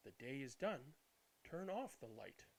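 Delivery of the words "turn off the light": ordinary delivery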